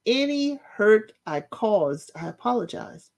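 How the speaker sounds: slow, deliberate "Any hurt I caused"; slow demonstration of a sincere apology; the proper apology. Shown as a demonstration. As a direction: model the right apology slowly. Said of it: "What you should say is".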